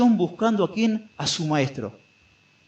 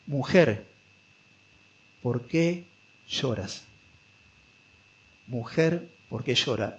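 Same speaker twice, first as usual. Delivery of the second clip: softer, with long pauses, gently asking a grieving woman; loving exhortation; 5 semitones lower, 5 dB quieter, slower than usual. Acted. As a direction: ask her tenderly, leaving space between words. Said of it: "una exhortación amorosa".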